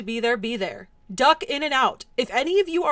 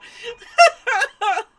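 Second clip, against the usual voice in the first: squealing laugh-cry; high wheezing laugh; 11 semitones higher, 7 dB louder than usual. Real, not acted. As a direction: crack up hard. Cracking up at chat.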